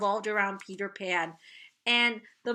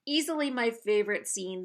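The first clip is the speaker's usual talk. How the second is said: ordinary delivery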